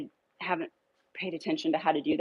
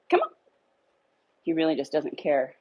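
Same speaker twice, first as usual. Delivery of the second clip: ordinary delivery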